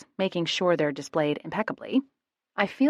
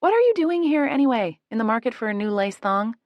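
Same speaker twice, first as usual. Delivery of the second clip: teasing jab; 5 semitones higher, 5 dB louder than usual. A narrator's reading. Needling him over the counter.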